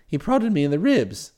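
plain reading voice

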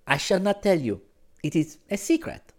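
put-on high, coy girl's voice; uncertain smile. A narrator's reading. Girl keeps her secret from him, uncertainly. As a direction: withhold it with an unsure smile. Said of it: "she said with an uncertain smile".

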